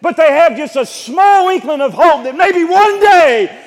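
high, loud, full-force sermon delivery; impassioned preaching. Real, not acted. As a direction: preach it out to the whole congregation, building to a shout.

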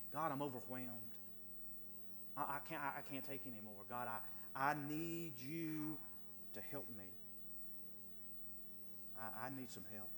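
fading into a hushed cry for help; quiet anguished prayer. Acted. Psalmist praying in tears to God, hushed and broken.

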